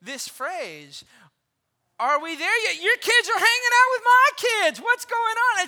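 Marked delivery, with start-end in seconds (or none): 2.0-5.6 put-on high, loud whiny child asking if they've arrived; cute kid voice. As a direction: play a little kid whining from the back seat. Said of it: "you hear this cute little voice".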